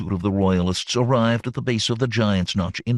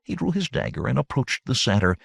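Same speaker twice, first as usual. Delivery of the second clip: ordinary delivery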